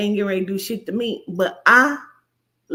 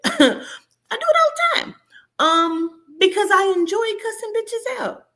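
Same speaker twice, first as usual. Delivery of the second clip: laughing; 11 semitones higher than usual. Real, not acted. Laughing at herself.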